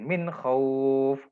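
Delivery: khauf with a throaty kh and held au; recitation example. Shown as a demonstration. Teacher reciting an example for students, measured. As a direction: recite min khauf like the Quran. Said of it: "mat lin".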